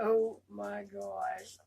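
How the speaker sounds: slow amazed gasp of words; awed. Real, not acted. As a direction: stretch it out in wonder.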